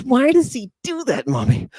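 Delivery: put-on high, far-off kid's voice; child asking mommy. Little kid asking his mommy why the lecturer does that, in a high child voice. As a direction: play a whiny little kid.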